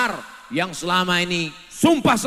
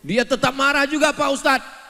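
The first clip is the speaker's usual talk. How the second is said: high complaining wife; 9 semitones higher than usual. Retold or imitated.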